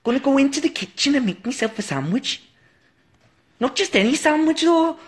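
very high, hammed-up Geordie; Geordie accent. Shown as a demonstration. Narrating a trip to make a sandwich, high mock Geordie. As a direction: squeak out a bad Geordie impression. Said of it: "I've got a Geordie accent".

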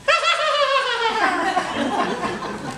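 high giggling monkey laugh; monkey cackle. Acted. Monkey laughing at the boy climbing, high giggle. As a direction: laugh like a tickled little monkey.

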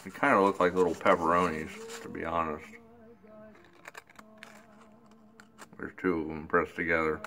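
talking around pipe stem; clenched pipe between teeth; pipe in mouth. Real, not acted. Seen 1: Pipe stem held in teeth throughout; removed by 9.2s.

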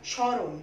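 স্মরণ said as শঁরণ, the ম silent and the স nasalised; nasal স্মরণ. Shown as a demonstration. Teacher showing pupils how to say স্মরণ, nasal স. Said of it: "সঠিক উচ্চারণ হচ্ছে".

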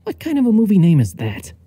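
high-pitched disbelieving protest; incredulous outrage. Acted. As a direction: heckle the TV in exasperation. Narrator outraged at the TV.

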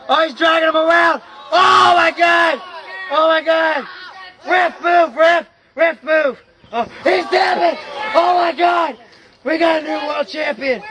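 high, shouted play-by-play at fever pitch; excited climax call. Real, not acted. Commentator calling a new world champion, excited shouting. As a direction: urge on the finish in a frenzy. Commentator calls the title finish.

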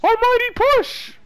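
high, loud anime battle cry; anime attack shout. Acted. Naruto attack quote.

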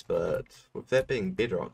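normal talking voice

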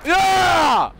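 long, high, loud cheer; exultant gamer yell; triumphant shout. Real, not acted. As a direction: scream it in victory. Celebrates in game.

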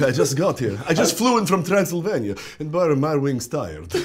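put-on Transylvanian accent, catching the breath; winded vampire just landed, Transylvanian accent. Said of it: "He's sort of always catching his breath".

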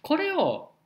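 ordinary delivery

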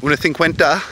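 imitating Galician Spanish with a lisp; high, lisped Galician Spanish; Galician lisp accent. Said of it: "everybody in Galicia has a lisp".

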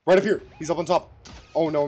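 loud, high callout of enemy position; urgent callout. Real, not acted. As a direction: shout where the enemy is.

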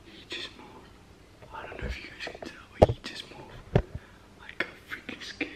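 startled hushed whisper; scared whisper. Real, not acted. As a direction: whisper it, frightened he'll wake. Friend stirs, scared.

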